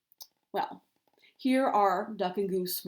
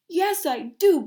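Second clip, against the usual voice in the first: louder, higher protest; cried out; 7 semitones higher, 9 dB louder, slower than usual. A narrator's reading. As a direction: snap back defensively. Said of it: "Goose cried".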